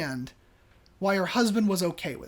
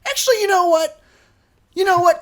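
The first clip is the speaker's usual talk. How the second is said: much higher and louder, sharp indignant quote; wife's indignant outburst; 10 semitones higher, 9 dB louder, faster than usual. Retold or imitated. Wife snaps back at her husband in the lobby, loud and high. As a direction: cut in like an offended wife. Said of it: "and she goes".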